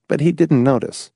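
ordinary delivery

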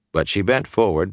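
plain reading voice

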